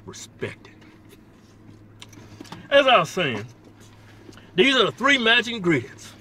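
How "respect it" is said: whispered with mock reverence; reverent whisper. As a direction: hush it like a sacred rite. Reverent about food.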